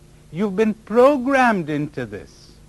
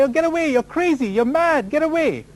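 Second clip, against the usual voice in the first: high, scornful brush-off; dismissive scoffing; 9 semitones higher than usual. Retold or imitated. People scoffing at a speaker they don't want to hear, high and mocking. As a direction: wave the speaker off as a crackpot. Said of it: "They say".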